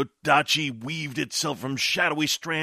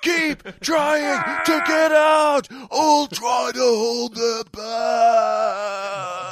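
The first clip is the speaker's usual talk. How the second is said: high, loud, slow command; urgent shout; 6 semitones higher, 5 dB louder, much slower than usual. Acted. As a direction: hold the line, shout it. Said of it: "he yelled".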